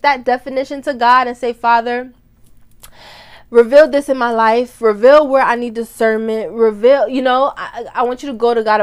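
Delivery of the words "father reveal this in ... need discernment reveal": louder, earnest plea to Father; fervent prayer. Pretend. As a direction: pray it out boldly, asking God to reveal. Modelling a prayer to God.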